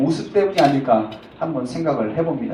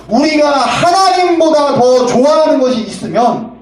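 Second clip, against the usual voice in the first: loud, slow, emphatic preaching; slow, booming pulpit call; preaching shout; 8 semitones higher, 6 dB louder than usual. Acted. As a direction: drive the point home at full voice. Preacher stresses the main point.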